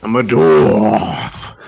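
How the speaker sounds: slow dwarf warrior voice; drawn-out heroic dwarf introduction; Taserface voice. Acted. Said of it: "my voice is based off of Laser or Taserface".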